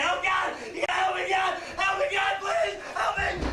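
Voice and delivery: loud, high screams for help; desperate high-pitched yelling; screaming. Acted. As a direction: scream as if falling backwards to the water. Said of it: "screaming the whole way".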